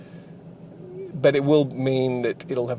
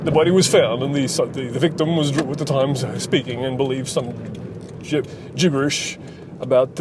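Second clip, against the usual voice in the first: Australian newsreader read. Shown as a demonstration. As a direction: newsreader delivery. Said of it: "that's my newsreader voice".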